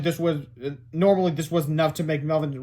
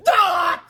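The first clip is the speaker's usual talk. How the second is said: high loud scream of frustration; frustrated scream; 8 dB louder than usual. Acted. Principal screaming in frustration. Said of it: "screamed in frustration".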